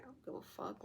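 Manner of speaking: soft, under-the-breath dismissal; quiet indifference. Acted. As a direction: toss it off barely voiced. Indifferent villain, first quiet take.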